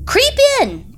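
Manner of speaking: high, loud witch urging; coaxing witch. A narrator's reading. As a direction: tempt her into the oven. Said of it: "said the witch".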